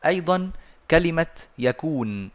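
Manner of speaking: normal talking voice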